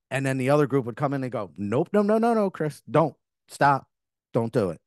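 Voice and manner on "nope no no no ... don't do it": urgent warning. Retold or imitated. Other group urgently warning Chris.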